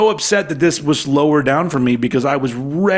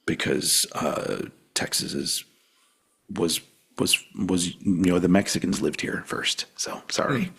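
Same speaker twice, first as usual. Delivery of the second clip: dropped to a near whisper, much softer; hushed aside; 8 semitones lower, 9 dB quieter than usual. Real, not acted. To co-host, a guilty whispered confession about Texas. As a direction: confide it under your breath.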